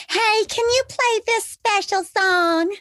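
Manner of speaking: high, slow, drawn-out sugary request; wheedling dancer. Acted. Dancer asking the DJ for a song, sweetly wheedling.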